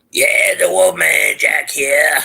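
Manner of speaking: half-hearted stab at the famous DJ voice, drawn out; Wolfman Jack attempt. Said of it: "I can't do it".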